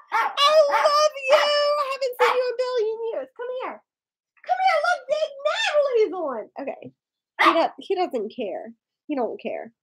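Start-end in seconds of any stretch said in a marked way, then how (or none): 0.0-6.1 high excited squealing hello to a friend. Real, not acted. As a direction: squeal with joy at seeing Natalie. Greeting friend Natalie excitedly.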